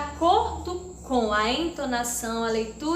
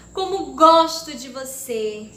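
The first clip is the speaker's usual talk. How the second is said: much louder affectionate exclamation; exclamatory; 7 dB louder than usual. Shown as a demonstration. Teacher showing pupils an exclamation by its sound, fond and loud. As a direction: burst out with fondness. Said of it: "Prestem atenção".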